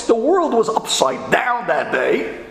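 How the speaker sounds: ordinary talk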